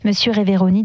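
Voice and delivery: plain reading voice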